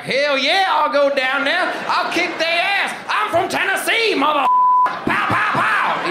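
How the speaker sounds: high, loud hillbilly yell; Andrew Jackson shouting. Retold or imitated. Comic Jackson hollers he'll kick their ass, high shout. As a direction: yell it like a wild frontier brawler. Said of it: "And he's like".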